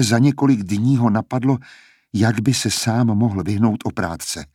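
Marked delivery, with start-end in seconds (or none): none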